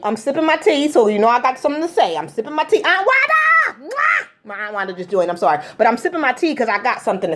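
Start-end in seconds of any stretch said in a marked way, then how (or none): 2.8-3.8 high, drawn-out happy shout; excited call out. Real, not acted. Greeting relative joining live. 3.9-4.3 blown kiss. Real, not acted. Blowing kiss to aunt.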